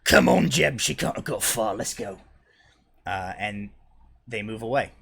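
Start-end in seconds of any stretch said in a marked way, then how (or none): 0.0-2.2 loud opening, easing off to leave; thug giving up. Acted. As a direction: call a partner away in a thug voice. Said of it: "and he goes".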